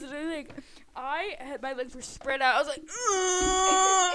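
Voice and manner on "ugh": long, high, loud groan; mock pained wail. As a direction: wail as if scalded, hammed up. Acts own pain after scalding.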